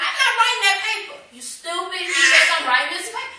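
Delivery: high, loud defiant refusal with a laugh; hammed-up tantrum over the paper; going off. To the church, reliving refusing the senior paper, loud defiance. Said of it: "I'm just really going off".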